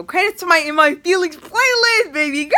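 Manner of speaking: loud, high-pitched gushing; high, loud and over the top; hammy shout-out. Pretend. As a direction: belt out a playful shout-out.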